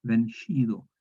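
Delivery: "vencido" with a sh, ben-shi-do; old Spanish sound. Shown as a demonstration. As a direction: say "vencido" as benshido, old palatal sh. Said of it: "para pronunciarlo como J antigua".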